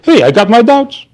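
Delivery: high-pitched mock protest; cocky doubter. Doubter answering God's gifts with an excuse, mock protest. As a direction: imitate someone airily claiming their doubts. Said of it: "we walk around and say".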